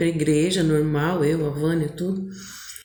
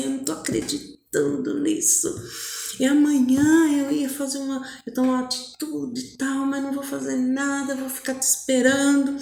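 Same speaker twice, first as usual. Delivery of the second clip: put-on higher, softer voice of a smitten boy; retold happy disbelief; 4 semitones higher, 3 dB quieter, faster than usual. Overjoyed boy promising to wait for her, retold happiness. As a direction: imitate his dreamy joy, lightly lifted. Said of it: "E ficou todo feliz".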